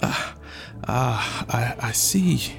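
faint, halting stammer; weak squeal. Acted. Chief cowed by a roaring suitor, weakly. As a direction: falter softly, thoroughly intimidated. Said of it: "the chief squealed weakly".

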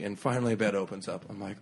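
usual speaking voice

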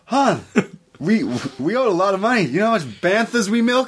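high, mock Scottish gangster demanding money; a hint of a Scottish accent while chasing a debt; Scottish gangster voice, lightly done. Scottish gangster demanding Han's debt, a mock Scottish accent.

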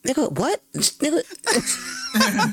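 high, laughing disbelief; mock disbelief. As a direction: squeak it out through a laugh. Imitating his own reaction, laughing.

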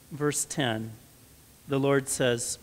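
ordinary delivery

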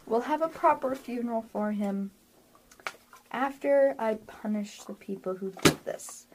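soft, controlled grief; composed after breath. Acted. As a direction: hold it down quietly, with restrained menace. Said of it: "takes a deep breath".

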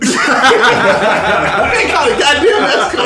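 drawn out through laughter; slow, laughing repeat. Real, not acted. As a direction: crack up while repeating the punchline. Repeats his joke amid laughter.

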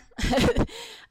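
bright burst of laughter; high laugh. Real, not acted. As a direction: laugh it off, relieved. Laughs after long plug.

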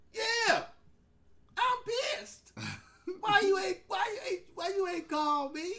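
loud, high mock fury from mama; scolding her son for not calling; angry mother.

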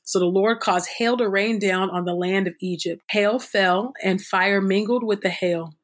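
normal talking voice

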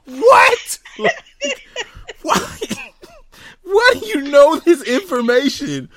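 very high, loud squeal of bafflement; hysterical, incredulous outburst; laughing disbelief. Real, not acted. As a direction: shriek the questions through real laughter. Cracking up in disbelief at friend.

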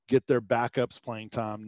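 usual speaking voice

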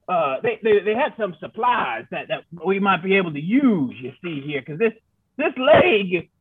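a grizzled frontiersman rambling; old prospector voice. Acted. Player voices his character.

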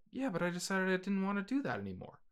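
high, fast, small excuse; Mario as sheepish son. Acted. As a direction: make a quick high-voiced excuse to Dad. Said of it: "have Mario be like".

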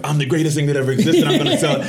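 high, loud cocky boast; mock braggart. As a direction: brag like a smug salesman. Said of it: "so many people are like".

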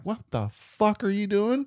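high, slow mock disbelief; incredulous. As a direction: imitate a wife calmly appalled at her husband. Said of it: "looks over at him like".

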